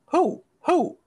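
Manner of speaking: high, loud owl hooting. Pretend. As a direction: hoot it like an owl. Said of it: "You a fucking owl?".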